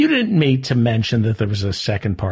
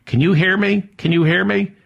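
higher and louder, calling over him; 6 semitones higher than usual. Acted. Host calling to co-host who keeps talking, louder. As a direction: raise it to cut through his talking. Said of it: "look, he keeps talking".

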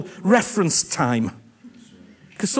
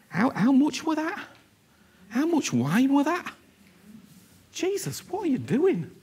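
higher-pitched, fussy questioning; mock disapproval; 5 semitones higher than usual. Rule-keeper to Jesus over poured-out wine, mock disapproval. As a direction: play a scandalised rule-keeper, lightly.

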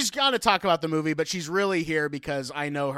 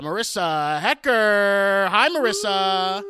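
slow, drawn-out announcer intro; 3 semitones higher, 7 dB louder, slower than usual. Acted. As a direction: present her like a game show host. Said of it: "Please welcome to the podcast".

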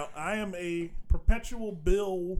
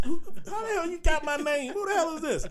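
mock outrage; 9 semitones higher than usual. Acting out his angry reply.

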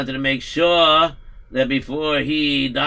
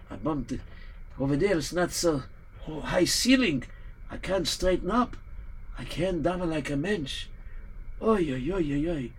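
soft pained sighs; 9 dB quieter than usual. Acted. Rabbi softly lamenting in pain.

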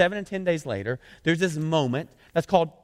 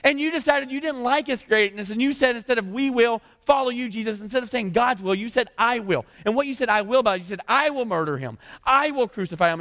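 preaching; 6 semitones higher than usual. Retold or imitated. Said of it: "And he goes".

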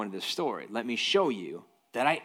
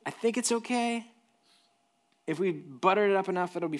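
mock-casual reassurance; 8 semitones higher than usual. Mock reassurance about old fish.

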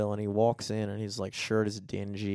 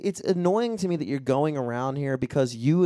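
usual speaking voice

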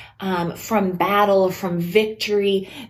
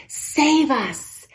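higher pleading cry of save us; 5 semitones higher than usual. Shown as a demonstration. Crowd crying to Jesus for rescue, pleading. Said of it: "this plea, this cry".

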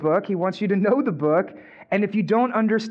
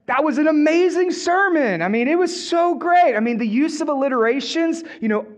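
higher, bright flattering gush; gushing praise; 6 semitones higher than usual. Acted. Peter flatters Jesus about his sermon, gushing and higher.

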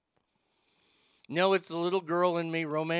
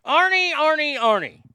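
high, loud yell of the name; all-caps shout; 10 semitones higher, 10 dB louder than usual. Acted. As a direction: read it out as if shouted in capitals. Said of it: "All caps".

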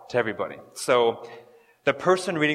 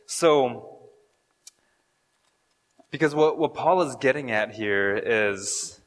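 ordinary talk

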